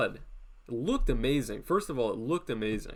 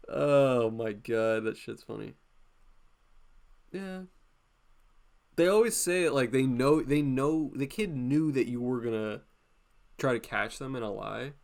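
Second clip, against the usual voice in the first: ordinary talk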